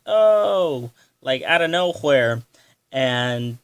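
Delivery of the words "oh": a sudden high, loud gasp of an oh; surprised oh. Retold or imitated. Retelling the moment Jorah shows up, surprised oh. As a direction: caught off guard, let the oh jump up.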